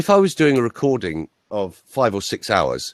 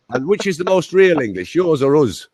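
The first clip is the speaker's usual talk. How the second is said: put-on high Scottish-accented challenge; Scottish accent, raised pitch; Scottish farmer; 7 semitones higher, 3 dB louder than usual. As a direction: imitate a Scottish farmer pressing the question. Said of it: "about nine Scottish farmers".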